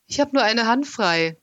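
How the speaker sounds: desperate. A narrator's reading. Boy dangling in the pit pleads with Skoll, desperate. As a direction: plead in despair, hanging on. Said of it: "sagte ich verzweifelt".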